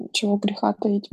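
ordinary talk, nothing marked

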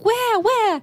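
high eager calls, searching around; excited where. Retold or imitated.

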